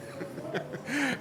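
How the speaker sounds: airy chuckle; a breathy teasing laugh. Acted. Teasing after a jab.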